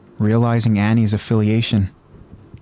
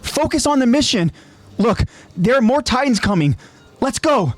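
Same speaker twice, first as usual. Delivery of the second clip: put-on high, pressing young voice; urgent young soldier; 10 semitones higher than usual. Marco urging his comrades, high and urgent. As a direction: urge them back to the mission.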